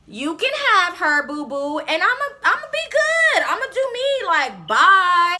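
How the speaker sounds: high-pitched, sassy send-off; dismissive breakup. Retold or imitated. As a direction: re-enact brushing off a boyfriend. Retold breakup with boyfriend.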